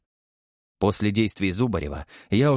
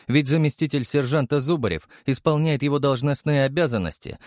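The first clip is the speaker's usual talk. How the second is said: plain reading voice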